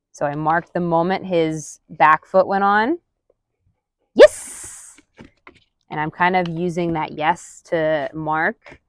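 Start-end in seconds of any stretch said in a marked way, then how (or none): 4.1-5.0 a very high, loud yes; high excited marker. Real, not acted. Trainer marking the dog's back foot with a high squealed yes.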